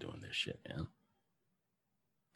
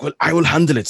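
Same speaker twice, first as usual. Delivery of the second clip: put-on thick German accent, raised and loud; Werner Herzog; 7 semitones higher, 9 dB louder than usual. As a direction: mimic a German director taking charge. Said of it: "in his thick German accent".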